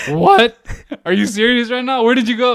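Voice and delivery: loud, high disbelieving questions; incredulous outburst. Retold or imitated. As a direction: act out exasperated disbelief at your friend. Incredulous at friend found safe.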